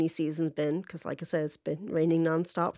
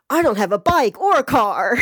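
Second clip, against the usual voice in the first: loud, high and triumphant; loud one-upping retort; 5 semitones higher, 11 dB louder than usual. Retold or imitated. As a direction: top them all with a shout. One-upping in car argument.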